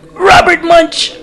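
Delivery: put-on high, slow childlike voice; little kid answer. Six-year-old naming his favourite book, high and slow. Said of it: "And I was like".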